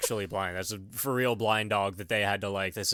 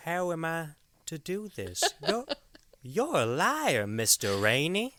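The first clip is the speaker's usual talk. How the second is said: slow, higher, menacing drawl of a Southern stalker; Shooter impression; 5 semitones higher, slower than usual. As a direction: play him as a creepy, slow accuser. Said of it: "Wow, that was perfect".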